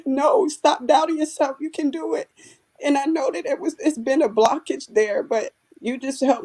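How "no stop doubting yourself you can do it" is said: higher, urging inner voice; voicing the self-encouraging inner child; inner pep-talk voice. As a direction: coax yourself like an inner cheerleader. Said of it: "and it's like".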